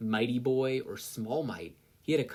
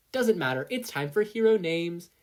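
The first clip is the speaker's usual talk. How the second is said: higher and louder, eager; brushing it off; 7 semitones higher, 6 dB louder than usual. Acted. Midnight shoving past Aizawa, eager and loud. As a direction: barrel over the objection.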